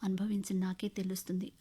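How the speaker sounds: usual speaking voice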